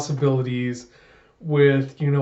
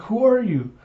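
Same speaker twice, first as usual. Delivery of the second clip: high-pitched mock surprise; startled question; 9 semitones higher than usual. Dog meets a strange girl, startled question. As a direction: blurt it out, taken aback.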